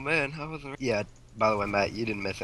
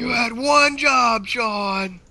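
mock scolding yell; 12 semitones higher, 5 dB louder, much slower than usual. Scolding teammate in game.

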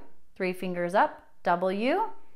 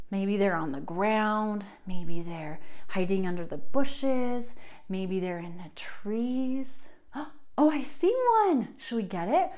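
ordinary talk

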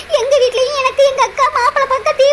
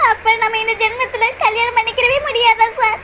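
despairing tearful question; crying. Acted. Priya to her lover, losing hope of marrying, crying. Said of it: "அழுகாத".

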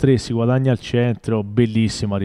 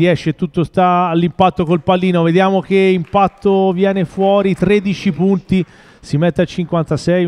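breathless italian goal commentary; 7 semitones higher, 4 dB louder than usual. Real, not acted. Tense live call of the shot.

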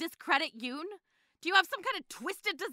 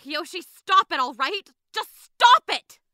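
loud, building to a shout; angry outburst; 9 dB louder, slower than usual. Acted. Friend ordering Kyoshi to stop pretending, furious. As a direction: explode to cut her off.

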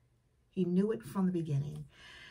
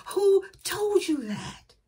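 slow, high, loud demand; God's challenge; 13 semitones higher, 8 dB louder, slower than usual. Acted. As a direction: fire it back as God, slow and big. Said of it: "you know what God says".

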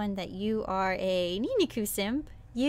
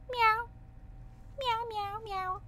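high cutesy meowing; playful meow; 11 semitones higher, 4 dB quieter than usual. Pretend. Vtuber meowing to show off cat ears. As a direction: meow like a kitten.

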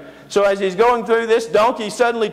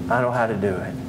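sly Balaam; 9 semitones lower, 4 dB quieter, faster than usual. Acted. Balaam confides his plan to the king, lower and sly.

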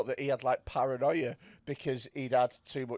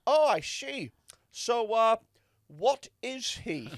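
put-on higher-pitched, muddled Dutch accent; puzzled Dutch skipper, words drawn out slowly; mock Dutch captain; 7 semitones higher, slower than usual. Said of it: "the Dutch captain goes".

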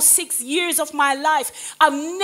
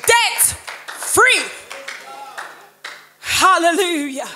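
loud, higher, ringing sermon voice; full-force preaching; 5 semitones higher, 4 dB louder than usual. Real, not acted. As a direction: preach it out to the whole church. Preaching testimony to the congregation.

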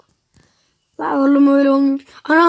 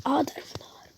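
hushed callout; whispering; 16 dB quieter than usual. Real, not acted. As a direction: whisper the enemy position. Whispered callouts during game. (fısıltıyla bildirme)

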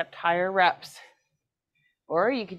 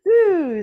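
effortful woo; 10 semitones higher, 9 dB louder than usual. Real, not acted. Straining mid-set, cheering.

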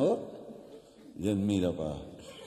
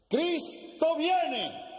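high, long-drawn preacher's cry; street preacher; much slower than usual. Acted. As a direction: proclaim it slowly across the park. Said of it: "Y repite la frase".

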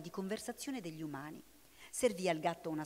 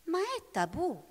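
bristling alarm; 10 semitones higher, 6 dB louder, slower than usual. A narrator's reading. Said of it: "miagolò Bubulina con il pelo ritto".

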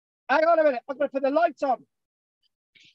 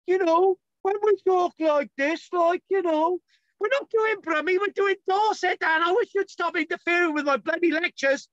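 higher-pitched put-on Brummie accent; 5 semitones higher than usual. Shown as a demonstration. Lecturer joking about doing Dorset instead, Brummie accent. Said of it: "We gotta do the Brummie accent now".

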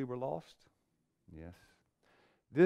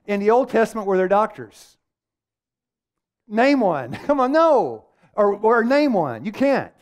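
higher, louder, driving challenge; preaching at full force; 7 semitones higher, 7 dB louder than usual. Acted. Preacher challenging the congregation, full force. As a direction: press the room hard for an answer.

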